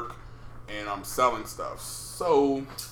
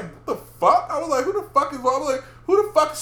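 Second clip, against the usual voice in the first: high, loud retold disbelief; baffled outcry; 7 dB louder, faster than usual. Boyfriend woken by accusing girlfriend, high baffled outcry. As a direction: act out bewildered protest, pitched way up.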